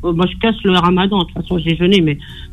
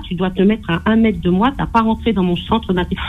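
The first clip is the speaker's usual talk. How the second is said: ordinary delivery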